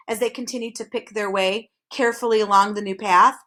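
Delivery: usual speaking voice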